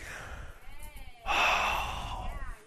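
soft unvoiced sigh of wonder; breathless sigh of awe. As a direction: breathe out in amazement. Awe at birth of child.

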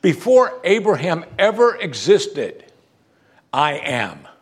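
slow, emphatic declaration; bold proclamation. Acted. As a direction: proclaim it grandly, word by word. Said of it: "who talks like this?".

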